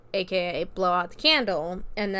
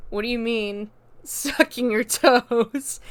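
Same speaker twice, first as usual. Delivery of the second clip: incredulous; 3 semitones higher, 6 dB louder, slower than usual. Retold or imitated. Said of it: "Haley is like".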